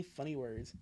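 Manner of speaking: ordinary talk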